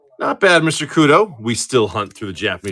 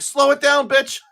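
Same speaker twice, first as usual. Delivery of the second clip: high-pitched exasperated mock yell; retold scolding; 13 semitones higher, slower than usual. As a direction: re-enact telling off a driver, pitched way up. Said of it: "I'm like".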